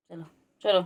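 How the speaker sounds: normal talking voice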